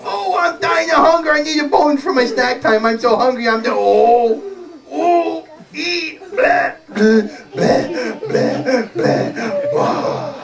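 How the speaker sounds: high-pitched melodramatic starving dog; mock dying. Dog begging for a bone, hammed-up dying. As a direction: play-act dying of hunger in a high voice. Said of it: "I was just acting".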